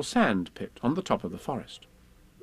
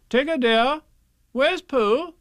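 higher, louder motherly asking; motherly Kanga; 13 semitones higher, 5 dB louder, slower than usual. Acted. Kanga asks Tigger about Pooh, motherly higher. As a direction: ask Tigger gently like a mother.

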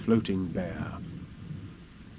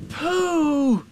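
high, loud, drawn-out exclamation; joyful cry; 5 dB louder than usual. A narrator's reading. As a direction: cry out in joy at finding a friend. Boy finds his friend.

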